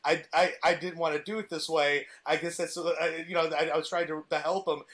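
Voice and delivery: usual speaking voice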